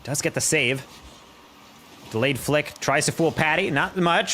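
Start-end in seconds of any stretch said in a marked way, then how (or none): 2.1-4.3 rising, much higher as the goal goes in; excited play-by-play. Acted. Caster to chat, hyped play-by-play call. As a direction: call the pinball goal with growing hype.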